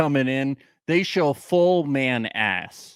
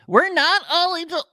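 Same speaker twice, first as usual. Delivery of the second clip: high, mock-indignant complaining voice; hammed-up hurt Texan; offended Texan reviewer; 12 semitones higher than usual. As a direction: whine like an offended Texan fan. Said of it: "one-star reviews from people from Texas".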